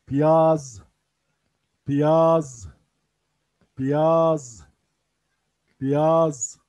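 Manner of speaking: Piyaz said loudly and clearly, falling at the end; loud clear demo. Shown as a demonstration. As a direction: call out Piyaz to the class. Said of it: "Urduca'da soğan anlamında". (Piyaz kelimesini sınıfa yüksek sesle söyle)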